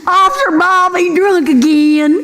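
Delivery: high, laughing, whiny complaint to the officer; whiny trailer wife. Retold or imitated. Woman on Cops complains to the officer, high and whiny. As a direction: wail like a fed-up trailer wife.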